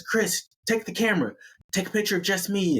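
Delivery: high, lightly put-on order; acted high-pitched insistence; bossy Amy voice. Amy's acted bossy photo order.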